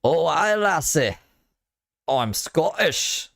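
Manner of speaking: a lightly attempted Scottish accent; hammed-up Scottish brogue, last line drawn out slowly. Shown as a demonstration. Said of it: "Scottish accent?".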